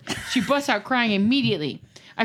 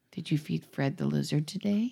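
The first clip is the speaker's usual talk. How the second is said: soft, whispered calm question; 6 semitones lower, 6 dB quieter than usual. Retold or imitated. Mom quietly asking her daughter about feeding the lizard, whispered. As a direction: ask it in a hushed, innocent whisper. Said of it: "I tell her calmly".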